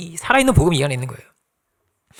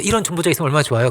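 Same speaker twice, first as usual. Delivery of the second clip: ordinary talk, nothing marked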